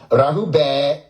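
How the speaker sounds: "Rahuba" with a rising end; rising Rahuba demo; another tone. Shown as a demonstration. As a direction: lift the end of "Rahuba" upward. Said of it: "There go another tone".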